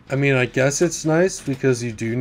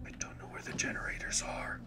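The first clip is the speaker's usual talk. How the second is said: softer hushed whisper, hiding from the killer; breathy whispered aside; 16 dB quieter than usual. Real, not acted. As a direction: whisper so she can't hear you. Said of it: "I'm whispering as if that'll".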